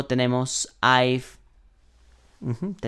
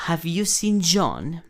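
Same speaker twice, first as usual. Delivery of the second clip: slow model question, "Have you seen John" lifted high; teacher's careful repeat-after-me "Have you seen John"; question intonation; 5 semitones higher, much slower than usual. Shown as a demonstration. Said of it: "debes ponerle cierta entonación".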